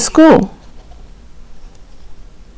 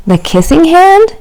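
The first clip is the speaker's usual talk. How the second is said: put-on higher, wondering child's question; curious raccoon child, voice higher; 7 semitones higher than usual. A narrator's reading. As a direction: ask it like a puzzled little kid. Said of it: "asked Chester".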